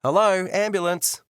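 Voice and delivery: high, slow call through a door. Acted. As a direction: call out to be heard inside. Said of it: "a voice calls out".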